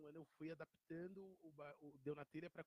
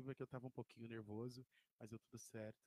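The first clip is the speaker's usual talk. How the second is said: ordinary talk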